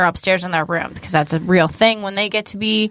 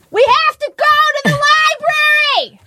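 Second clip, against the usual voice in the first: high, fast holler; urgent yell; much faster than usual. Retold or imitated. Mom yelling to get kids moving, urgent holler. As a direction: yell it as if there is no time to lose. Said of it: "most of my yelling".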